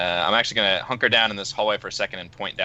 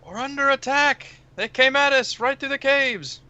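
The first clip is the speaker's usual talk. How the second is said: very high, drawn-out cry of alarm; alarmed report; slower than usual. Acted. Player acts the panicked alarm.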